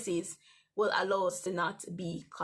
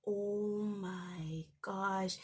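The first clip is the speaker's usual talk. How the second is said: slow, drawn-out shocked oh my gosh; breathless disbelief; stunned gasp; 5 dB quieter, much slower than usual. Retold or imitated. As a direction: let it sink in, slowly. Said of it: "I was like".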